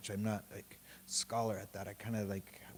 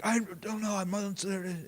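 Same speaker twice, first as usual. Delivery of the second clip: much higher, plaintive voice; whiny helpless; 10 semitones higher, 3 dB louder than usual. Retold or imitated. On the phone to his wife: high, helpless whine. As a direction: sound lost and helpless. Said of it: "I'm just like".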